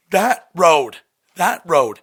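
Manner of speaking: loud, repeated insistence; telling the mother about the road, loud and urgent; insistent. Retold or imitated. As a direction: hammer the point home.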